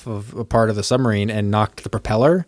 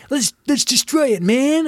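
high, pumped-up mock officer voice; eager mock XO. Ward urges the captain to attack, high and eager. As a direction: squeak it out like an overeager officer. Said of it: "He's like".

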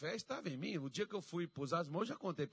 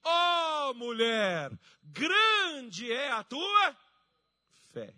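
high, slow, booming proclamation; Jesus exclaiming; 11 semitones higher, 15 dB louder, much slower than usual. Retold or imitated. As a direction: cry out in drawn-out praise. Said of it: "ele diz assim".

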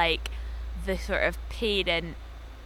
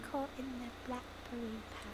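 very soft, higher vampire impression; Drusilla; 4 semitones higher, 15 dB quieter than usual. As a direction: echo the vampire's line, pitched up and very quiet. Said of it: "Love Drusilla's accent".

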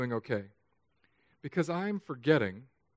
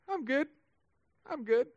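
mock fine answer; 12 semitones higher than usual. Pastor imitates the stock reply to how-are-you, falsely upbeat. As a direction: fake being fine in a high little voice.

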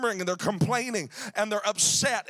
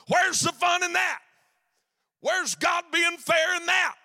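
indignant protest; 6 semitones higher than usual. Acted. Preacher protesting unfairness.

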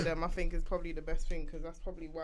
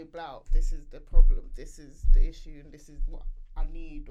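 loud, slow, spelling it out; child explaining clearly; 3 semitones lower, 15 dB louder than usual. Retold or imitated. Mother voicing her son explaining his problem, loud and deliberate. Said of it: "just say".

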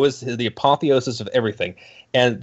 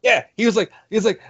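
usual speaking voice

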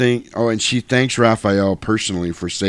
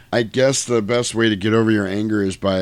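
ordinary talk, nothing marked

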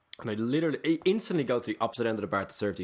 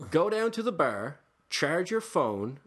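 slow, over-clear directions; slow patient explaining; 5 semitones higher, much slower than usual. Retold or imitated. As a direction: spell it out slowly as to a child. Slow explaining to confused woman.

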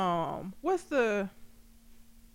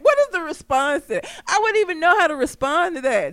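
ordinary talk, nothing marked

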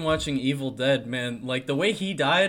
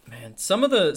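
ordinary talk, nothing marked

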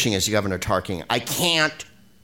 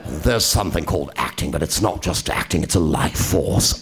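pompous critic voice; 6 semitones lower than usual. Pretend. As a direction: intone it like a self-important critic. Mock pompous critic read.

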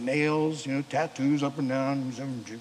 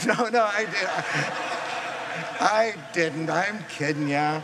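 chuckling through a joke, louder; laughing; 3 semitones higher, 6 dB louder than usual. Real, not acted. The pastor jokes to the congregation about the beer, laughing. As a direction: tease the crowd with a laugh. Joking he did have beer.

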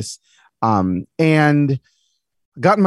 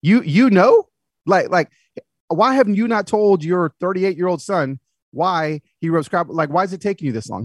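incredulous; 4 semitones higher than usual. Retold or imitated. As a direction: demand an answer, higher and in mock astonishment. Son stunned his dad already knew.

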